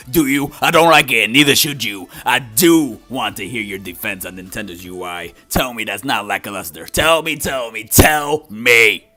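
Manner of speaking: loud, pushy challenge; arguing with myself. Acted. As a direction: demand an answer, badgering. Second voice challenges the reviewer.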